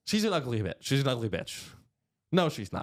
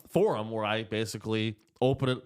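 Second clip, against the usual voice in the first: normal talking voice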